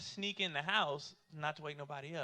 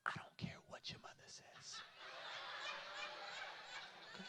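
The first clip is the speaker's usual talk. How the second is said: whispering; 16 dB quieter than usual. Retold or imitated. Dad laying down the law to son, whispering. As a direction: whisper it sternly, no voice at all. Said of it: "Then why are you whispering?".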